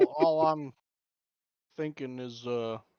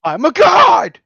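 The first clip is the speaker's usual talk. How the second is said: 1 boast; 12 semitones higher, 14 dB louder, slower than usual. Acted. Player boasts in character.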